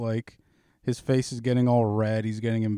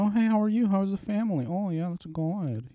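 mock polite small talk; 9 semitones higher than usual. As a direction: parody a boring chipper greeting. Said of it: "Your favorite is going".

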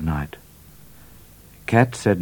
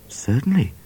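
a higher whispered word; 7 semitones higher than usual. A narrator's reading. Midnight answering the trooper in a whisper. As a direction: breathe it out.

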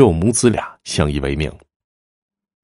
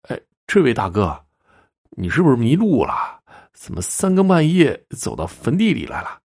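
higher-pitched, wondering youth; puzzled questioning; 6 semitones higher than usual. A narrator's reading. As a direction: question a stranger in raised, baffled tones. Puzzled boy questions stranger at grave.

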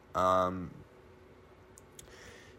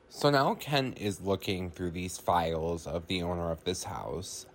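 ordinary delivery

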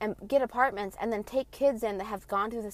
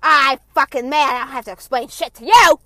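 loud, higher furious shout as mom; mom yelling; 5 semitones higher, 16 dB louder than usual. Retold or imitated. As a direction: roar it like a mother who won't explain. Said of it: "She's like".

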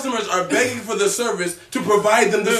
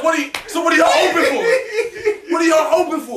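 higher, laughing demand; mock outrage through laughter; laughing indignant question; 8 semitones higher, 3 dB louder than usual. Customer asking staff why they're open, laughing higher. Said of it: "I said".

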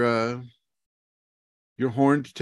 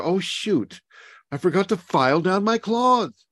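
higher, put-upon mock voice; mock fussy tiger; 9 semitones higher than usual. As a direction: ham up a dainty tiger who forgot his nails. Mocking a tiger filing claws.

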